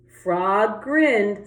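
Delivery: ordinary delivery, nothing marked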